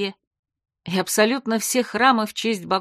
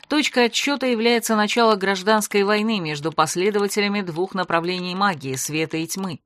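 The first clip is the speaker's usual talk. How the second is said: plain reading voice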